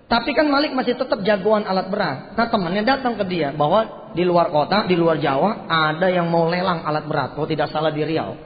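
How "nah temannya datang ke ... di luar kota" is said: ordinary talk, nothing marked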